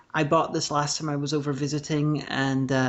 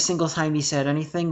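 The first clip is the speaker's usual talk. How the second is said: usual speaking voice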